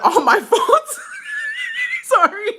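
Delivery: reading that breaks into high laughter; bursting into laughter. Real, not acted. Reader fails to get through the letter, bursting out laughing. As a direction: lose it halfway through the sentence. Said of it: "I don't know why I'm laughing".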